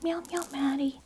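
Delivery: put-on high, slow little cat voice for the kids; voicing the cat. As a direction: speak for the cat in a tiny high voice. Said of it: "She says".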